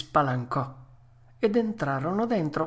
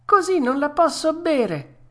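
high-pitched balky complaint; fussy puppet; 13 semitones higher, 4 dB louder than usual. Acted. Puppet fussing over the glass, high whine. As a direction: make a great fuss, voice pitched up. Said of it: "disse il burattino facendo mille smorfie".

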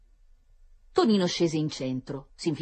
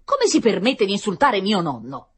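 loud, raised-pitch outrage; indignazione offesa; 5 semitones higher, 8 dB louder than usual. A narrator's reading. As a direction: demand an apology, deeply affronted. Offended gentleman quarrels with shop assistant. (sdegno ad alta voce)

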